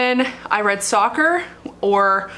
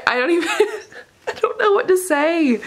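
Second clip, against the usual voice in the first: tearful laughing; 7 semitones higher than usual. Real, not acted. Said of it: "I was so emotional".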